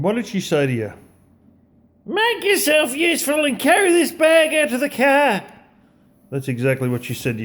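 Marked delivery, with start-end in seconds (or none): 2.1-5.4 put-on high, loud Australian mother's voice, bossing the kid; Australian mum accent. Mother at the grocery store ordering her kid, in a high Australian accent. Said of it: "Maybe not that accent".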